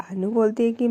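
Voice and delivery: normal talking voice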